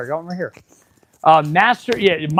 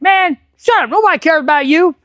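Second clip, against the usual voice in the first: mock dismissive yell; 8 semitones higher than usual. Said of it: "the other dude's like".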